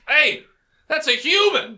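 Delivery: high, loud alarmed shout; elf raising alarm. Acted. Game master's elf points out the intruder, alarmed shout. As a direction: holler it across the street as an accuser. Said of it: "He points at you and says".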